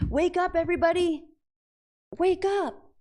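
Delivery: high, loud call to wake everyone; hammed-up wake-up cry; rousing town-crier shout. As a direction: holler it out like a street crier. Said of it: "That was me in my, in the fifteen hundreds".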